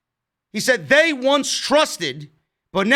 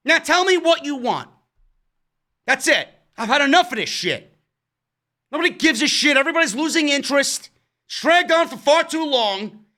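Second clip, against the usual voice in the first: exasperated rant; 5 semitones higher than usual. Real, not acted. Fed-up rant about a dragging storyline.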